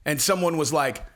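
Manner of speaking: ordinary delivery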